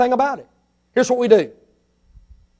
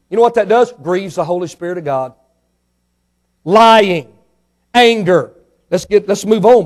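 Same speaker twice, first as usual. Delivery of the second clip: ordinary talk, nothing marked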